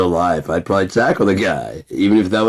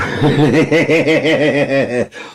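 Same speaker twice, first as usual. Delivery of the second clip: high burst of laughter; 7 semitones higher, 3 dB louder than usual. Real, not acted. Laughing at own joke, loud laugh.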